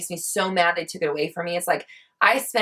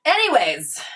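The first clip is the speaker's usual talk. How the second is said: normal talking voice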